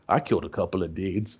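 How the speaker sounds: low, quiet menacing brag; low deadpan frat guy. Pretend. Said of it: "He's like".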